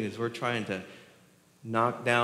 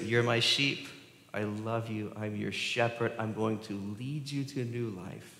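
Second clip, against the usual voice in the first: softer, lower, gentle promise; tender Jesus; 3 semitones lower, 7 dB quieter than usual. Retold or imitated. Jesus gently reassuring his sheep, softer and lower. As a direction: speak it tenderly like a shepherd.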